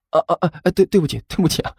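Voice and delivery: high, stammered apology; obsequious apology. A narrator's reading. Man bowing to an annoyed moviegoer, fawning apology. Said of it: "甘球点头哈腰的道了歉".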